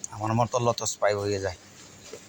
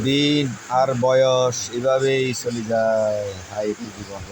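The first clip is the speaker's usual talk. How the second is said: slow recited saying; 5 dB louder, much slower than usual. Acted. Closing reflection on passing days.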